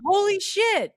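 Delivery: high, loud excited exclamation; hammed-up holy shit; mock kid cursing. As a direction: blurt it like a thrilled kid. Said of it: "because I was cursing".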